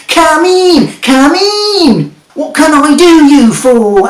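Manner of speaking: high, loud, slow and overjoyed welcome; excited wizard. A narrator's reading. Wizard greeting his visitors at the door, wildly excited. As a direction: greet the visitors as if they are old friends. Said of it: "asked the wizard excitedly".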